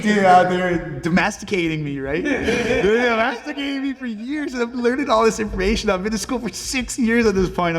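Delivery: high-pitched, laughing through the words; half-laughing comic complaint; laughing rant. Real, not acted. Joking rant about being caged.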